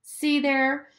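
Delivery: put-on high, triumphant child; pointed high gloat. A narrator's reading. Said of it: "scowling triumphantly".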